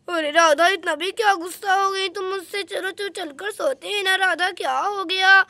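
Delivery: ordinary delivery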